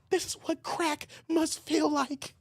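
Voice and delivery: high, slow, hushed cartoon-kid voice; a kid stunned by the taste of a burger, said slowly; dazed Riley. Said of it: "Riley ate that burger talking about".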